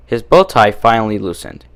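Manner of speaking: ordinary delivery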